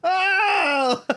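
very high, drawn-out oh; hammed-up weepy cry; mock tearful wail. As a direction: wail it high as if overcome by sweetness. Reacts tearfully to a touching scene.

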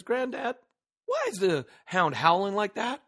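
put-on young grandson. Grandson asks his granddad on the porch, curious childlike voice. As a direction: pitch it up like a small boy asking.

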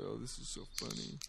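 bored mocking teen, quick and soft; teenager impression. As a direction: sneer it like a snotty teen. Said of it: "I like your teenager impression".

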